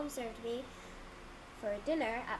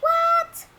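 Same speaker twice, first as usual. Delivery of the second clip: very high, loud shriek; outraged disbelief; 13 semitones higher, 13 dB louder than usual. Retold or imitated. Storyteller replaying the news at Walmart, outraged shriek. Acts out reaction to bad news.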